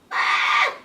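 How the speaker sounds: short, loud shriek. A narrator's reading. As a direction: shriek out in alarm. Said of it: "shrieked Pip".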